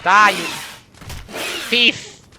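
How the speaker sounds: loud high yell; shouting at an enemy; battle yell. Real, not acted. Attacking creature in game.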